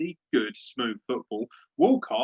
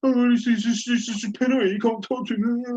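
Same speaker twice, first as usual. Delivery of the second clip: high, loud whining mock voice; mock him as a whiner; whiny pundit; 11 semitones higher, 5 dB louder than usual. Mocking the pundit crying penalty, high whining. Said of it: "be like".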